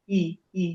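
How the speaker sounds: ই said as a clear, drawn-out ee; ই sound demo. Shown as a demonstration. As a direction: sound out ই like ee with spread lips. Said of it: "সেটা হয়ে গেছে ই". (দীর্ঘ ই উচ্চারণ করে দেখানো)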